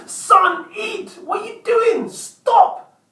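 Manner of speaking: put-on high, loud scolding shout at a child; retold angry dad shout. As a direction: snap orders as a fed-up dad, voice jumping up. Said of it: "I shout at him".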